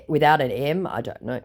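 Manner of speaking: ordinary talk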